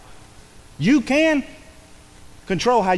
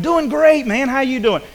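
a touch of cheerful, higher, louder greeting; mildly enthusiastic hello; upbeat greeting; 5 semitones higher, 4 dB louder than usual. Retold or imitated. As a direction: sound happy to see them. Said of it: "And then you go to the next person".

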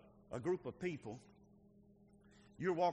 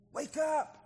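calling out; 10 semitones higher, 6 dB louder than usual. Real, not acted. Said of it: "preacher calls church awake".

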